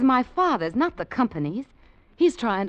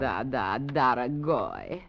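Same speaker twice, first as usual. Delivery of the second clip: fake Russian accent; 7 semitones lower, much slower than usual. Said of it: "What happened to your Russian accent".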